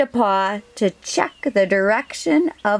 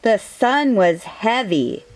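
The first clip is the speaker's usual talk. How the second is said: ordinary delivery, nothing marked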